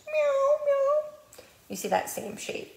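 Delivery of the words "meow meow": very high kitty meows; cat meow. Shown as a demonstration. Librarian meowing for the cat picture, very high.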